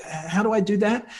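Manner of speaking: high, bewildered "how do I"; baffled self-question. Retold or imitated. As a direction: ask yourself, lost and a little exasperated. Voicing own confusion in Spanish.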